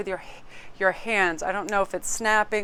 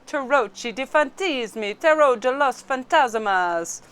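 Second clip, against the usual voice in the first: slow, higher Italian, then Spanish pronunciation; 5 semitones higher, 4 dB louder, slower than usual. Shown as a demonstration. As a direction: relish the Italian and Spanish words slowly. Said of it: "in all the languages".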